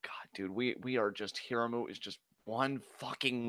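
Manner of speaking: breathy dejected whisper. Real, not acted. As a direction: whisper it, crushed.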